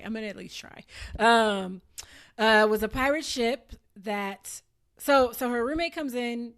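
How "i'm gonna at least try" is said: ordinary talk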